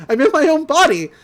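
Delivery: fast, high and loud, bursting with relief; excited exclamation. Retold or imitated. Recapper voicing a freed character, excited. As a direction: blurt it out gleefully.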